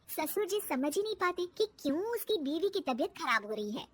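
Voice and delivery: plain reading voice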